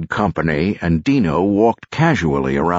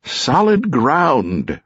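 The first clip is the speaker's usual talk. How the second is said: higher, drawn-out cry of relief; mildly gleeful, stretched exclamation; gleeful yell; 8 semitones higher, much slower than usual. A narrator's reading. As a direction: glad to be back on land. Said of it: "Rosie yelled gleefully".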